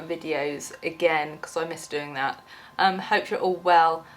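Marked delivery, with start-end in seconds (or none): none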